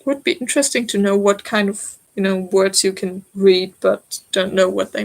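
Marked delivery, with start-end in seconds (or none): none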